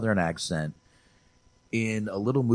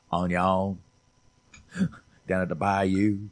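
mock Louisiana accent. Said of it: "That's Louisiana right there".

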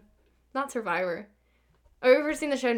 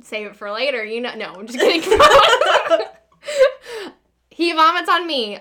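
ordinary talk

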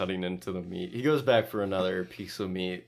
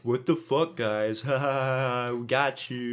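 higher voice with a fake gotcha laugh; teasing, raised gotcha voice; mock prankster reveal; 3 semitones higher than usual. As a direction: play the prankster springing his joke. Imagines prankster revealing his prank.

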